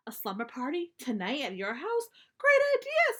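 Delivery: can't contain the delight; thrilled squeal. Acted. Sister hears about the slumber party, thrilled squeal.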